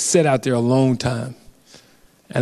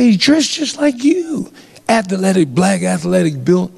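pushy cop quote; 3 semitones higher than usual. Retold or imitated. Retelling a pushy cop's accusation.

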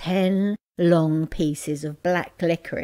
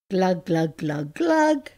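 playful glug glug drinking noises; gulping sounds. Acted. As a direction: mimic gulping hot sauce. Drinking pepper sauce.

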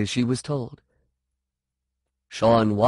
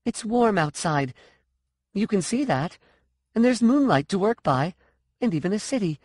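high, indignant arguing; exasperated, challenging character voice; insistent challenge; 9 semitones higher than usual. A narrator's reading. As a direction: press the point with a pitched-up voice. Continuing angry challenge in story.

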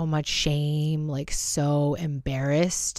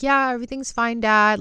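higher-pitched mock-cheery fine with a catch; retold brave front, near crying; imitated choked-up reassurance; 6 semitones higher, 5 dB louder than usual. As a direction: act out covering up tears, pitch lifted. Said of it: "Like, choked up".

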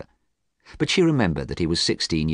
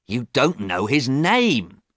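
slow, emphatic indignation, pitched up; angry; 6 semitones higher, 3 dB louder, slower than usual. A narrator's reading. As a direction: hammer it out crossly, word by word. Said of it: "James said again angrily".

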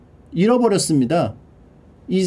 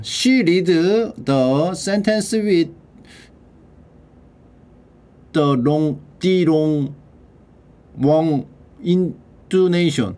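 very slow, word by word; slow teacher read; much slower than usual. Shown as a demonstration. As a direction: stretch each word so learners follow. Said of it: "왜 이렇게 빨리 읽어".

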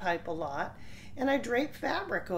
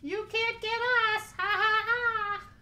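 much higher mock ha ha taunt; mocking laugh; 14 semitones higher, 4 dB louder than usual. Goats laugh at the troll, high and mocking. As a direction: tease the troll in sing-song. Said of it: "they laughed at the troll".

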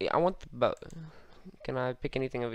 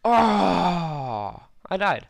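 yelling while running away; 4 semitones higher, 8 dB louder, much slower than usual. Real, not acted. Just got killed in game.

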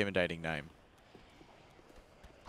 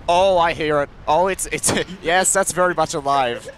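alarmed, louder and higher; rising alarm, laughing; excited; 5 semitones higher, 8 dB louder than usual. Real, not acted. Hears the living dragon.

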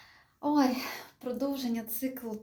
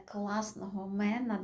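usual speaking voice

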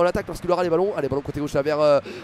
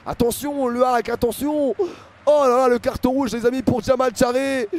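raised, incredulous match commentary; excited play-by-play; 5 semitones higher than usual. Real, not acted. Commentator announcing Charé's red card to viewers, urgent and raised. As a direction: hammer out the shock of the red card.

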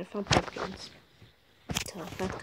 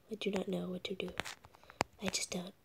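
whisper; 9 dB quieter than usual. Real, not acted. Gamer whispering his confusion to the camera. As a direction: breathe it out like a secret.

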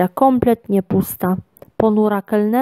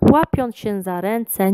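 plain reading voice

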